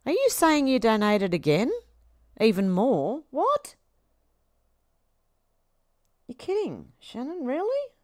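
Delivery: can't believe it, pitch shooting up on the questions; incredulous surprise. Real, not acted. Thanking a viewer who donated again, in disbelief. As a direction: voice leaping high in disbelief.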